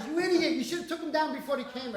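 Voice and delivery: high, loud mock telling-off; imitating scolding wife. Voicing wife scolding him.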